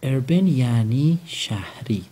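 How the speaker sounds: slow and drawn out for the learner; slow model. Shown as a demonstration. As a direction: stretch each word so the child can copy it. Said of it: "teacher slowly models new word". (آهسته و کشیده برای شاگرد)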